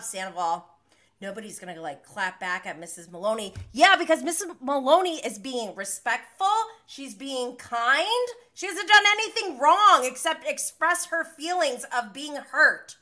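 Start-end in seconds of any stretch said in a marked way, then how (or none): 3.7-13.0 loud, heated sticking up for someone; indignant defence. Real, not acted. Host defending Missus Maloney against Sandoval, indignant. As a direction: argue loudly on her behalf.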